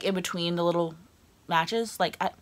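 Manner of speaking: usual speaking voice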